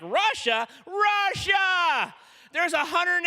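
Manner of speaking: high, loud "Russia!" then a pressing explanation; wife's excited shout. Retold or imitated. Wife answering her husband's plan, loud and high excited shout. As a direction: cry it back at him, fired up.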